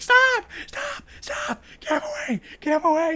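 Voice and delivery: very high, crying mock panic; hammed-up frantic pleading; freaking out. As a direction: shriek it in laughing mock terror. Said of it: "And she's like".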